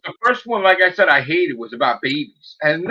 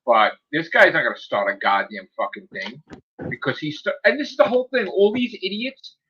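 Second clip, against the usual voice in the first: normal talking voice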